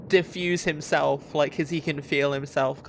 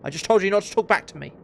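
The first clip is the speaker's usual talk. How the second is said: quick, raised scolding as a guard; angry guard; 4 semitones higher, 5 dB louder, much faster than usual. Acted. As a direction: scold fast, louder and higher. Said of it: "just getting more and more angry".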